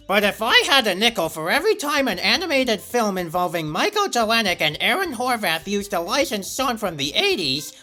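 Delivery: camp up the nickel meme as a zany cartoon villain; Doctor Doofenshmirtz impression. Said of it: "I do an impersonation of Doctor Doofenshmirtz".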